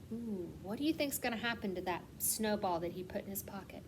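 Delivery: hushed suspense whisper, a little quick. Real, not acted. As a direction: lean in and whisper the mystery. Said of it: "whispered suspense question to kids".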